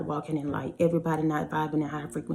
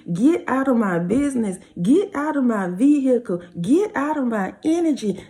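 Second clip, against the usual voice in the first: building declaration; 3 semitones higher, 4 dB louder than usual. Acted. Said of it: "building lyric chant".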